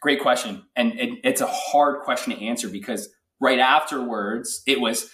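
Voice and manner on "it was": ordinary talk, nothing marked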